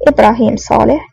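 plain reading voice

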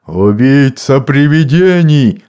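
loud, slow furious yell; mad monk yelling. A narrator's reading. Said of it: "заорал сумасшедший монах". (яростный крик безумного монаха)

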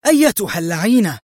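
high, angry cry; screamed curse. A narrator's reading. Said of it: "تصرخ".

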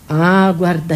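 ordinary talk, nothing marked

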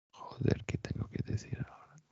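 hushed, breathless whisper of inner panic; an actor whispering his own panicked thoughts. Retold or imitated. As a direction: whisper it like a frantic thought to yourself. Panicked inner thought while walking.

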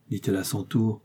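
usual speaking voice

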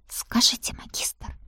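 unvoiced shaky whisper; trembling whisper. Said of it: "прошептала я, голос дрожал и не слушался".

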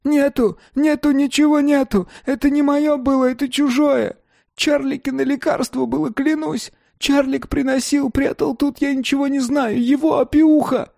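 very high-pitched panicked squeal; wailing. A narrator's reading. Beaten dealer swears he has nothing, wailing. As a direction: wail frantic denials in a squeaky, pleading voice. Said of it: "вопли Рублёвки".

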